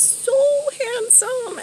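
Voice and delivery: gushing. Retold or imitated. Gushing over how handsome pilot was.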